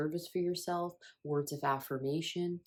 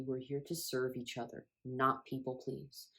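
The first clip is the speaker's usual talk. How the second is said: usual speaking voice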